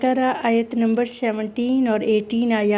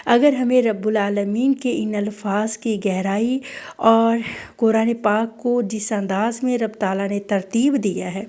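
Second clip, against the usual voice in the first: normal talking voice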